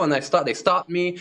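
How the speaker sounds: ordinary talk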